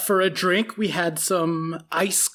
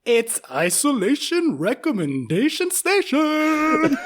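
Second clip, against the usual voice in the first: segment announcer; 8 semitones higher, much slower than usual. Acted. Introducing the recommendation segment to his co-hosts, as a slow, booming announcer. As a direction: boom it out like a game-show title.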